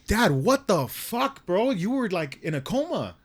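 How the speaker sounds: high, stretched-out retold outburst; exasperated disbelief. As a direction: scold in incredulous relief, drawing words out. Acted scolding of waking dad.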